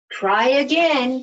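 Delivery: a hint of a firm teacher; capital T. Capital T telling the letters to line up, firm. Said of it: "said capital T".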